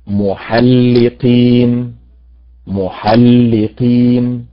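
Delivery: "محلقين" drawn out very slowly, twice; stress question. Shown as a demonstration. Teacher reads محلقين slowly for students to find stress. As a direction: stretch "محلقين" syllable by syllable. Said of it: "أين النبر فيها؟".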